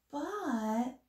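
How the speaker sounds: ordinary delivery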